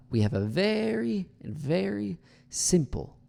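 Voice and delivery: VERY drawn out very slowly and pitched high; stress on VERY. Shown as a demonstration. Coach shows word stress, long high VERY. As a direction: stretch each VERY high before SIMPLE. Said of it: "Depending on what word you stress".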